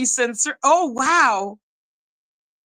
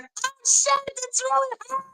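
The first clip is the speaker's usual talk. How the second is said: much higher and softer, caught off guard; the moment you realize it is really her; stunned surprise; 14 semitones higher, 6 dB quieter than usual. Retold or imitated. Retold hearing her answer.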